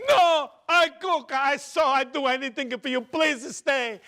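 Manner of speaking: loud, high pleading Italian mother; crying Italian mama. Retold or imitated. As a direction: beg your son to stay, in accent. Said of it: "my mother's crying".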